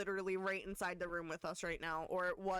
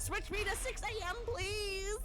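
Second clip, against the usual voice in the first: high-pitched desperate plea; frantic begging; 11 semitones higher than usual. Real, not acted. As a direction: beg at the top of your voice. Begging for six AM.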